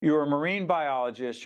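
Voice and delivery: usual speaking voice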